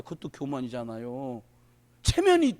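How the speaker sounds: ordinary delivery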